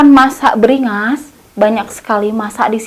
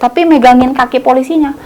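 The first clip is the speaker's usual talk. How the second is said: ordinary talk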